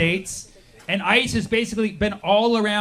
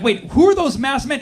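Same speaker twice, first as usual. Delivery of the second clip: higher, louder sudden alarm; mock surprise; 6 semitones higher, 6 dB louder than usual. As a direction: catch yourself in alarm. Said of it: "you're like".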